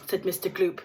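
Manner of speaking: plain reading voice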